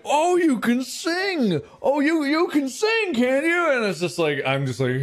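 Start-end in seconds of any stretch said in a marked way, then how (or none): 0.1-3.7 high, stretched-out party-guest cooing; gushing adults. Retold or imitated. Party adults to a child who supposedly sings, high and gushing. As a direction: mimic adults making a fuss of a kid. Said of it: "all these adults are just like".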